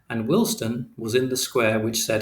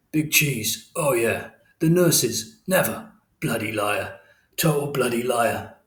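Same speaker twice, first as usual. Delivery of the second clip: put-on drunken ramble; slower than usual. A narrator's reading.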